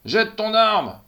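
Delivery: loud, high, breathless command; barked order. A narrator's reading. Gunman orders the policewoman, shouting breathlessly. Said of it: "ordonne le type, essoufflé".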